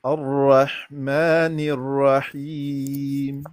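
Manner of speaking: الرحمن الرحیم with a light, slight roll on را. Shown as a demonstration. Teacher shows the light takrir on ra. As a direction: roll the r only a little, slowly. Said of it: "را میں نا تھوڑی سی تکریر".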